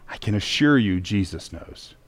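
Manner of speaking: soft, low and sincere; quiet assurance. Real, not acted. Pastor comforting his flock in the pandemic, soft and low. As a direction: lean in and reassure them quietly. Preacher's intimate reassurance.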